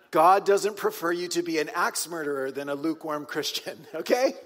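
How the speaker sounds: laughing in disbelief while saying it; half laughing through the words. Real, not acted. Laughs at his own notes.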